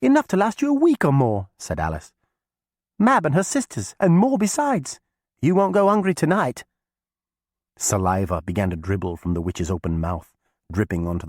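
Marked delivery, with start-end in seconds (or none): 0.0-1.5 put-on high, loud girl's call; young girl voice. A narrator's reading. Alice tempts the witch. 3.0-6.6 put-on high, loud girl's call; young girl voice. A narrator's reading. Alice tempts the witch.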